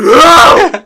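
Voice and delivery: high, loud fake crying "No!"; mock tantrum wail. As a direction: wail like a toddler who dropped a toy. Kid melts down over dropped thing.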